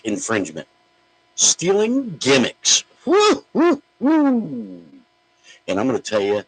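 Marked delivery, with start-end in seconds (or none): 1.4-2.8 high, slow and loud, stretching each word; loud drawn-out announcement. Real, not acted. Host announcing his next topic, loud and drawn out. 3.1-4.8 shrill falsetto wrestling woos, loud; high Ric Flair woo. Pretend. Host hyping his topic with loud falsetto woos.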